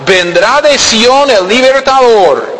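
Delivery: high, slow proclaiming of "vendrá de Sión el libertador"; scripture reading. Acted.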